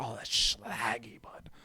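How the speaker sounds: hushed whisper, slow and soft; mock whisper of praise; whispered slang. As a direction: breathe it like tasting something great. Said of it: "dish or something like".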